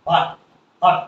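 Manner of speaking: "হট" cut short on an open "ah"; short "ah" sound. Shown as a demonstration. Teacher repeats the model word, "হট" with short "ah". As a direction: say "হট" as a short "hah-t", pause between. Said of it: "আ উচ্চারণ হচ্ছে হট".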